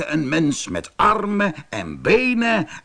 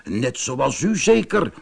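plain reading voice

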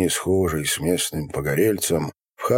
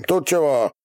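higher-pitched brusque question; demanding shout; 9 semitones higher than usual. A narrator's reading. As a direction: demand an answer in a raised voice. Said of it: "пуще будто оглохший заорал Ярыга". (требовательный окрик)

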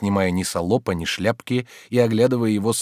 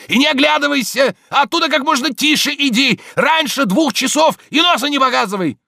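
high, loud, sustained yelling; shouting after someone; 7 dB louder than usual. A narrator's reading. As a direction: call commands after a runner. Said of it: "кричал он ей вслед". (громко крича вслед)

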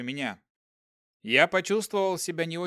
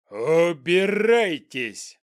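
roaring goblin; 7 dB louder, much slower than usual. Acted. Goblin shouts at general.

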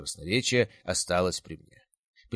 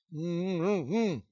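angry gagged moan; 10 semitones higher than usual. A narrator's reading. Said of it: "гневное мычание".

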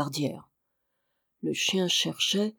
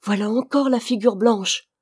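put-on mildly surprised child's exclamation; exclaiming; 3 semitones higher, 4 dB louder than usual. A narrator's reading. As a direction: point it out with a touch of surprise. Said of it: "s'écria Georges".

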